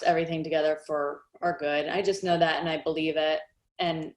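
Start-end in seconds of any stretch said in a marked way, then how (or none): 3.8-4.1 getting emotional. Real, not acted. Guest apologizes to host for getting emotional about dad, softly choked up. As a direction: falter with pauses, then a soft, quick apology. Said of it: "sorry, I'm gonna get emotional".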